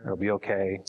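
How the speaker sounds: low-key, supportive comfort; sincere reassurance. Shown as a demonstration. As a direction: reassure them simply, without talking down. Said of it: "figure out how to do that, just say".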